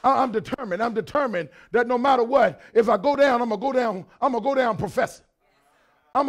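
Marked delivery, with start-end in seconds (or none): none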